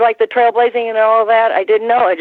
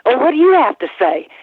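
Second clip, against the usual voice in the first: officer's question; 7 semitones higher than usual. Retold or imitated. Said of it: "and he goes".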